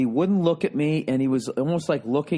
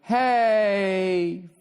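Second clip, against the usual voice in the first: high, drawn-out imitation of a heckler; passionless mock yelling; 7 semitones higher, much slower than usual. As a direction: mimic a woman's yell with no fire in it. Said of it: "Like, no passion, no fire, no pain".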